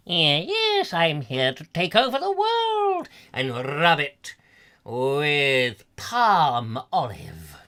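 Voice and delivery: slow, hammed-up villain; evil doppelganger. Evil twin announcing world takeover, hammed-up villain. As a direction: gloat with drawn-out villainous relish. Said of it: "is this an evil doppelganger".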